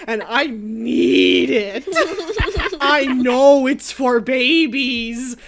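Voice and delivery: slow, loud, drawn-out begging; whiny pleading. Retold or imitated. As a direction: beg like a kid who needs it. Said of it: "I'm like".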